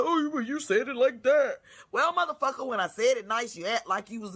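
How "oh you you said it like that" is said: mock whiny complainer.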